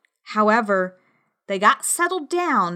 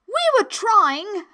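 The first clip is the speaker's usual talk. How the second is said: high, slow, meek excuse; pleading servant, pitched up; very humble; 12 semitones higher, 4 dB louder than usual. A narrator's reading. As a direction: explain yourself humbly to royalty. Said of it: "in a very humble tone".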